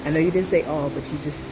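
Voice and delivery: ordinary talk, nothing marked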